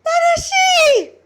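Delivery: shrill high scream of a name. A narrator's reading. Sister screaming as her brother collapses, piercing scream. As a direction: scream his name in horror. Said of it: "Asahi screamed".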